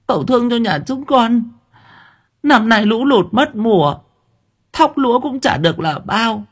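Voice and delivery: high, whimpering plea to the master; pleading peasant woman. A narrator's reading. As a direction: tenant woman begging the landlord's son for mercy, high and tearful.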